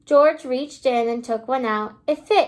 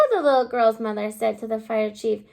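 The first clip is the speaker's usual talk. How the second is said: ordinary delivery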